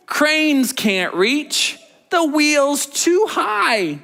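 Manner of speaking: very slow, high storytelling; slow dramatic reading. Acted. Teacher reads the rescue scene to kids, very slow and high. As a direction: stretch each word for suspense.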